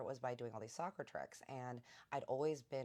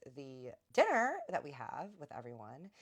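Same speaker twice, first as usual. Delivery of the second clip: ordinary delivery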